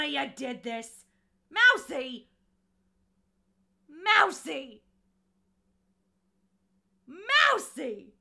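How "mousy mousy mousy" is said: loud, joyful call of the name. Real, not acted. Thanking a donor by calling their name loudly.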